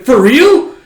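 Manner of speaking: high, loud amazed question; thrilled disbelief. Retold or imitated. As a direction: squeal it in happy disbelief. Frankie can't believe his luck.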